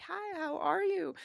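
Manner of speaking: high-pitched imitated hello; lightly acted, lilting how-are-you; friend's greeting. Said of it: "he comes over and he's like".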